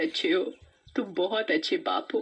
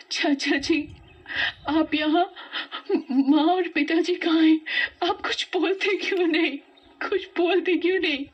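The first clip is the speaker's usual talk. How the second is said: high, tearful pleading that breaks into a cry; a scared girl begging her uncle for an answer; tearful, anxious; 10 semitones higher, 3 dB louder than usual. Acted. Pooja begs her uncle about her parents, high and tearful. Waking in hospital, asking for parents.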